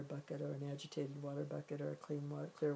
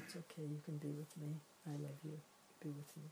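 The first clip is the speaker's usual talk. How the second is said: much softer, a gentle soothing murmur to your own upset mind; tender reassurance; 10 dB quieter than usual. Shown as a demonstration. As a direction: soothe it like a frightened child. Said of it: "You can just say".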